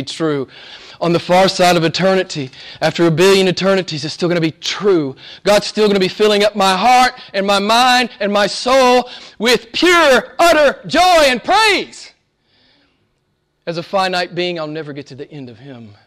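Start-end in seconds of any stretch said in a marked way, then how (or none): 5.4-12.1 soaring joy. Real, not acted. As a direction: voice climbing higher and louder into ecstatic praise. Preacher rising to a climax.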